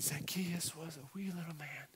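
put-on hushed, animated singsong for a toddler; animated storyteller. Dad singing to his two-year-old son, hushed and animated. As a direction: perform it like a bedtime song for a little boy. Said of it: "so I'd be real animated".

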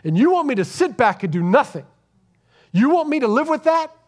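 rising indignant outburst, climbing high at the end; heated protest. Retold or imitated. Professor confronting her lawyer in court, indignant and rising. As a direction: push back hard, she won't sit still for this. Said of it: "and she goes".

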